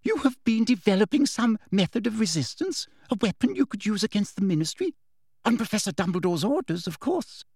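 high, eager interrogation; excited. A narrator's reading. Umbridge accusing Hermione of plotting, excited. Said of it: "eyes seemed to pop with excitement".

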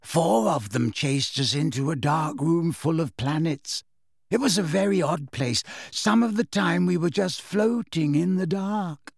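airy storyteller with lilting lifts; dreamy girl, recounting. Acted. Girl recounts a strange chase, dreamily. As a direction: drift through the tale unhurriedly.

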